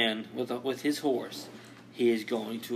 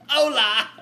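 high, loud mock British take on a Mexican caller; Mexican with British accent; 10 semitones higher, 5 dB louder than usual. Mexican caller with a British accent, shouting. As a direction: shout hola in a hammed-up British voice. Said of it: "Mexican with a British accent".

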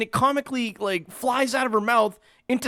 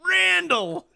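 higher, louder mock ring introduction; mock hype announcer; 7 semitones higher, 4 dB louder, much slower than usual. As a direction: announce the hero with hammed-up fanfare. Hyped mock ring introduction of Randall.

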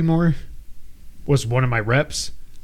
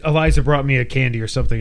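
ordinary talk

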